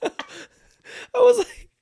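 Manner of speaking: real high squealing laughter; bursting laugh. Real, not acted. Dad cracking up at daughter's verdict, high helpless laughter. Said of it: "and I lost it".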